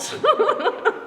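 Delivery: high breathy laugh bursting out; burst of laughter. Real, not acted. Preacher laughing at his own dad story. Laughs after dad's ear clip.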